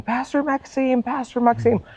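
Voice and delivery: calling out. Retold or imitated. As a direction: imitate a stranger hailing across the street.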